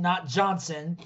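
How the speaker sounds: ordinary delivery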